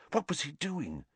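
plain reading voice

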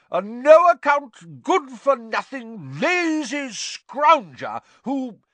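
very high, slow loud insult; Aunt Marge voice. A narrator's reading. Aunt insulting Harry's father, very high and slow. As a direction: spit out each insult.

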